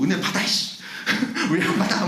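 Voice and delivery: hammed-up bullying preacher, breaking into laughter; half-hearted mock threat with a laugh; mock scolding. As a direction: playfully imitate a preacher demanding a response. Imitating preacher hammering listeners, laughing.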